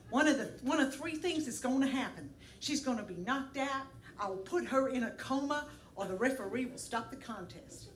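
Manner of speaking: a light Southern Tennessee twang, mock tough; imitating a Tennessee boxer's trash talk; Tennessee accent. Comedian reading rival's threatening email in Tennessee accent. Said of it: "this is a Tennessee accent".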